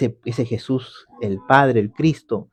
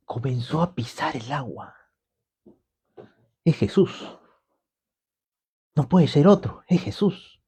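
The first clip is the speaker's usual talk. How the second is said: much softer, hushed and inward; hushed wonder; 6 dB quieter than usual. Acted. Preacher voicing Peter's awe, hushed and soft. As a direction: draw them in with a near whisper.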